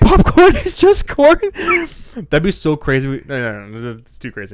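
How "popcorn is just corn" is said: high, loud, slow meme chant; shouted meme line. Acted. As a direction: belt it out as the meme. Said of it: "Like a new meme".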